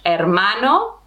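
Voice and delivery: "hermano" with no H, starting on the vowel, er-MA-no; "hermano" said as "ermano"; silent H. Shown as a demonstration. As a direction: drop the H of "hermano" entirely. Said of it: "pretend that the H is not there".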